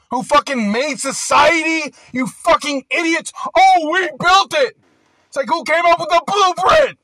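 real anger rising to a loud high shout; furious rant. Real, not acted. Ranting at people he blames, building to a loud angry shout. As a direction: yell it at them, fed up and furious.

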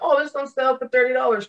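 mock delighted, pitched high; high-voiced imitation of a happy bargain hunter; pleased shopper. Shopper spotting a bottle on sale, mock delighted.

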